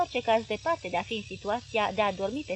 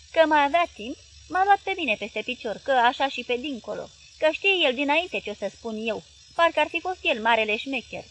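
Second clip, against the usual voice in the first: loud, higher aggrieved grumble; resentful husband; 4 semitones higher, 7 dB louder than usual. Acted. Husband complaining to his wife about the boy, loud and bitter. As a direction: vent the grudge loudly.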